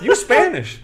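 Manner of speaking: high, loud verdict through laughter; laughing coach verdict. Retold or imitated. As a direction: deliver the punchline while cracking up.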